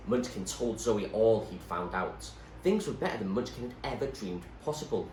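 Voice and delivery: ordinary delivery, nothing marked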